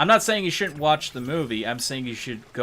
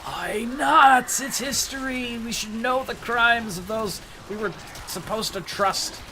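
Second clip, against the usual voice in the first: mock protest; 12 semitones higher than usual. Objector to hidden war crimes, high mock protest.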